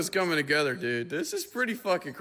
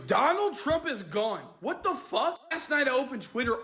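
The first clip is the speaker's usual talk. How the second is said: shocked; 8 semitones higher than usual. Real, not acted. Shocked news reaction.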